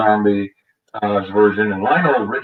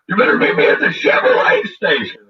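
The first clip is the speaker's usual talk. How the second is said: high, louder mocking voice of a threatening man; mock threat; 11 semitones higher, 4 dB louder than usual. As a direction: ham up his threat in a high voice. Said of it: "He's like".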